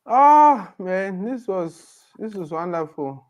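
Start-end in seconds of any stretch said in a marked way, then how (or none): none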